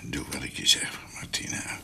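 zwak, fluisterend op het sterfbed; dying whisper. Acted. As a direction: fluister als een stervende man. Dying doctor whispers to Martine. (stervend gefluisterd)

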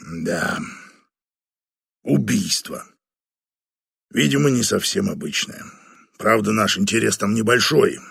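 put-on low, grave older boss; weary general reporting a case. Batanda briefs Flavia on murder.

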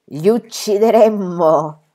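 put-on a small boy striking a brave pose for his father; heroic pose. A narrator's reading. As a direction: play the little hero, grandly. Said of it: "prendendo una posa eroica".

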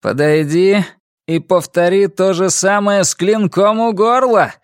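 high, forceful dare; shouted challenge. A narrator's reading. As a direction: dare him at sword point. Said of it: "крикнул он".

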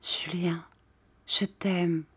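soft breathless whisper of love; whispered love confession. A narrator's reading. Jeanne whispers her love to Julien. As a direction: whisper it to her lover, barely audible. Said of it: "Elle murmura tout bas".

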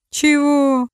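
high, loud, rude challenge; belligerent. A narrator's reading. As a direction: bark it back. Drunk challenging.

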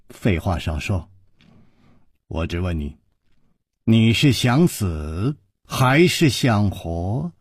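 low, quiet menace; cold, controlled interrogation; low threat. A narrator's reading. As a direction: keep it low and measured, then stretch the ultimatum. Villain threatens hero in narrated dialogue.

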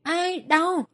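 high, sharp cry of pain; yelp of pain. A narrator's reading. Robber cries out as his wrist is stepped on. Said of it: "hắn đã hét lên".

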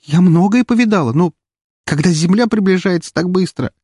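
high, overwrought voice; high-pitched, close to sobbing; shaken, near tears. Acted. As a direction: speak as if shaken by a near crash. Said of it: "дрожащим голосом и, кажется, всхлипнула".

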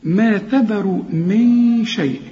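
slow ما تذر, light letters then thick "ru"; heavy ra only. Shown as a demonstration. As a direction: recite ما تذر من شيء drawn out, humming the n. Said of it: "لكن الراء هي المفخمة".

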